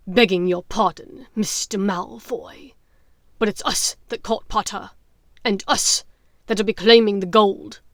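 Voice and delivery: a hint of a coarse werewolf. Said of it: "the werewolf was joining the fracas".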